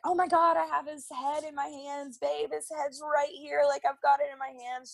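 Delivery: high and breathless with wonder; excited husband. Retold or imitated. Imitating her husband at the birth, high excited gush. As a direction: gush like a dad holding the baby's head. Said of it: "my husband was just like".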